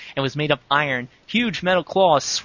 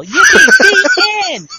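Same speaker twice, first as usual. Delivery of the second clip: very high, loud, slow "Yippee"; mock triumphant cheer; 12 dB louder, slower than usual. Finishing the fanfic for a friend, loud mock cheer. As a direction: stretch it out like a victory whoop.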